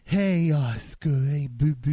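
slow mock coo to a pet; imitating cat-cooing friend. Friend leaning over the cat on the couch, imitated cooing. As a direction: lightly imitate someone sweet-talking a cat. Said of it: "you know how he does".

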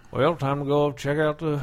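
put-on lightly done Southern family accent; mock Southern drawl. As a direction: imitate a slow Southern relative. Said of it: "my whole family has this".

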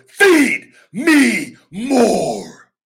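loud, high, drawn-out roar of feed; full-conviction catchphrase. Shown as a demonstration. Wrestler showing the catchphrase with total belief, roared. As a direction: belt it from the gut. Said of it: "What do you believe?".

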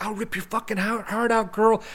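high, restrained imitation of a villain's yell; retold menacing yell. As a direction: imitate a threatening yell without full volume. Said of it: "he s-, yells at her".

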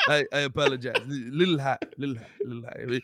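put-on half-hearted French-accented apology, stumbling over the words; mock French accent. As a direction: haggle timidly in a light French accent. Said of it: "I don't know why I made him French".

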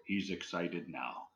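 slow, soft, low imitated voice; different ghostly voice. Teller mimics a second recorded voice, low and slow. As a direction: drop low and quiet, drawing it out. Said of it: "a different voice go".

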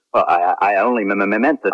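stumbling, nervous stammer on the first words; flustered clone stammering in front of the captain. Acted. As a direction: trip over the start of each word out of nerves. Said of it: "Why are you stammering?".

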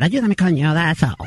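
put-on old father voice. Prank caller as the father complaining about his son, low gruff voice. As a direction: growl it like a grumpy old dad. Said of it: "father voice".

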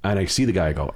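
ordinary talk, nothing marked

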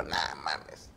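very low, soft retold mutter; muttered disbelief. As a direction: drop it right down under your voice. Retells runner's muttered disbelief. (incredulidad murmurada)

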